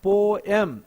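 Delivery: "Poem" in two clear beats, "po... em"; split into syllables. Shown as a demonstration. Teacher shows two sounds to students, "Po-em" syllable by syllable. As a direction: separate both vowels of "Poem". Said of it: "there are two again sounds".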